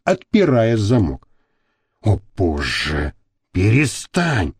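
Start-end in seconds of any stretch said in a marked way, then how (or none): 2.0-4.5 low, slow, soft moan. A narrator's reading. Man moaning under his lover's caresses, groaning. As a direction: groan out a weak protest. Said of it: "простонал Костолом".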